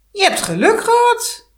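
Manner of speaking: high, loud begrudging hag; grudging witch. Acted. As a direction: spit out reluctant praise like a witch. Heks moet werk goedkeuren. (hoge, luide, wrokkige heksenstem)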